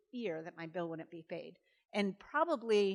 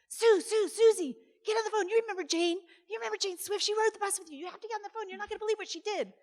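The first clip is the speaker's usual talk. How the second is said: high, loud excited yell to her daughter; mother yelling upstairs; 13 semitones higher, 6 dB louder, faster than usual. Retold or imitated. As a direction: holler across the house, thrilled. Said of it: "you could hear her yelling".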